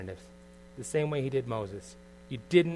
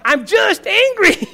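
slow, much higher and louder shout; retold yell; 14 dB louder, much slower than usual. Husband yelling at his wife mid-argument, slow mock shout. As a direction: drag out a hammed-up yell. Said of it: "I even said in the midst of yelling".

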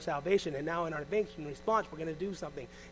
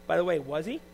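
ordinary delivery

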